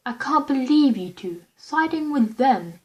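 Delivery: angry. A narrator's reading. Roman angrily scolding Virgil and Logan for siding with them. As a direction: lash out at the others for switching sides. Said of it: "Roman said angrily".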